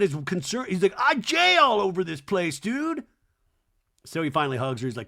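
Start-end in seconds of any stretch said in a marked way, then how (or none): 1.0-3.0 high, raised-voice protest; hammed-up high complaint; imitated complaint. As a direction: whine it loudly to a pal. Acting out man's loud complaint.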